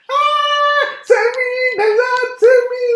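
very high, sing-song falsetto cry for help; mock terrified squeal. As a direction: wail like a panicked kid sliding down. Adi squealing in terror.

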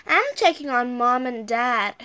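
ordinary delivery

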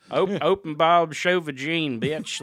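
loud, slow hammed-up hillbilly accent. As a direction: play a loud hillbilly creep, drawn out. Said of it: "You could do it, do it, like, in a hillbilly accent".

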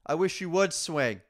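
loud, high mock taunt; taunting challenge. Retelling a line-cutter's challenge at Six Flags, loud taunting dare. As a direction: dare them to swing. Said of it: "someone was like".

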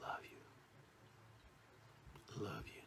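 hammed-up, very soft low whisper; romantic love. Shown as a demonstration. Speaker demonstrating cheesy romantic love, hushed. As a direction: murmur it intimately, barely audible. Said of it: "And then you get a romantic love".

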